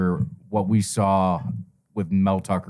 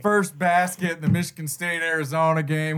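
higher, lightly voiced hyped-up gambler; light mock gambler voice, higher; Adam Sandler impression; 5 semitones higher than usual. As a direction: play a wound-up gambler calling the bet. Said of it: "you're doing Adam Sandler Uncut Gems".

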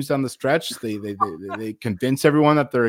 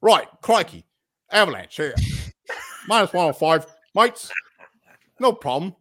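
put-on Australian accent; 4 semitones higher than usual. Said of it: "Oh, fucking English".

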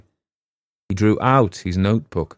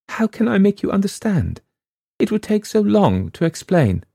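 much higher, anxious; distressed girl; 13 semitones higher, 3 dB louder than usual. A narrator's reading. Girl to Wyant, distressed. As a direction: struggle to explain.

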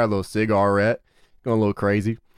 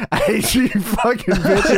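mock screaming; 9 semitones higher, 3 dB louder than usual. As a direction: screech it through laughter. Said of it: "that's who was screaming".

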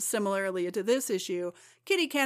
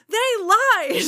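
higher and louder still, lied drawn out; bigger hammed-up lament; 12 semitones higher, 9 dB louder than usual. Kitty's second cry of betrayal, higher louder wail. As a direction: top the first one, wail it. Repeated line, even higher.

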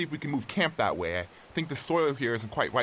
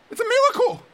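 high, loud cry of joy; high loud elated exclamation; overjoyed; 3 dB louder than usual. Acted. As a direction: burst out with disbelieving joy. Realizes rescue possible.

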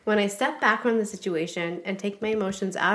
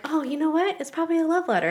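light, higher-pitched retold thought; naive hopefulness; 6 semitones higher, faster than usual. Wife replays finding the letter, naively hopeful. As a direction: lift it up as a hopeful little guess. Acts out her naive hope.

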